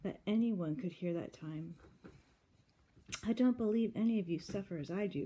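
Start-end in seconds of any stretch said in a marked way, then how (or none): 3.2-5.3 loud, emphatic storybook protest; mildly dramatic outburst of grievance; crying out. A narrator's reading. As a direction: cry it out as an aggrieved complaint. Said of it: "cried Amy".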